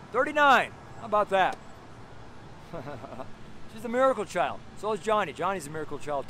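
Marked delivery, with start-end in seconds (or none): 1.6-3.7 low, soft chuckle; quiet run of laughter; laughing. Real, not acted. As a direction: chuckle to yourself, breathy and unvoiced. Amused at his own remark.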